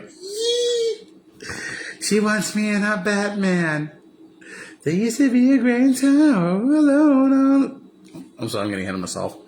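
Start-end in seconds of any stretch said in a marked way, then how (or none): 0.0-7.9 squealing high, slow and gleeful; giddy Bruce. Retold or imitated. Bruce thrilled Chase loves Batman, squealing high and slow. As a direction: shriek with delight, pitched way up. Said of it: "he turns around and he smiles like".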